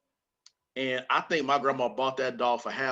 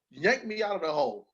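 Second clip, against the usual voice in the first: ordinary delivery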